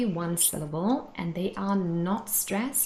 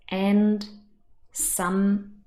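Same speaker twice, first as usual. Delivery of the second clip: "and" and "some" each said alone with full stress; stressed in isolation; 4 dB louder than usual. Shown as a demonstration. Teacher showing words said on their own, fully stressed. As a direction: give "and", then "some", full weight as separate words. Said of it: "in isolation and they are stressed".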